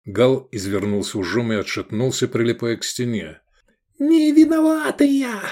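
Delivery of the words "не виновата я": very high, loud panicked squeal; shrieking. A narrator's reading. Cornered Akka pleading innocence, high shrieking squeal. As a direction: squeal your innocence in falsetto. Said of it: "заверещал Акка".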